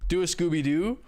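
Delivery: ordinary talk, nothing marked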